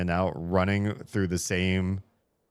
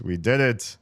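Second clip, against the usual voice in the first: louder, higher victory cry; louder, higher triumph; triumphant; 6 semitones higher, 6 dB louder than usual. Real, not acted. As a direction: celebrate the win. Puzzle solved.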